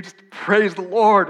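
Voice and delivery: loud, drawn-out shout of praise; exuberant praise. Acted. Preacher playing worshipper who just walked in, exuberant shout. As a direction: belt it out like an overjoyed worshipper.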